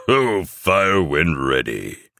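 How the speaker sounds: slow, lightly voiced Santa command; Claus. A narrator's reading. Claus gives the order to fire, slow Santa voice. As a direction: stretch out the order. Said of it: "replies Claus".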